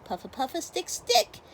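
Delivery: high, loud yelp of pain; sudden loud cry of hurt; pained howl. Acted. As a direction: howl like you just grabbed a hedgehog. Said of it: "he howled".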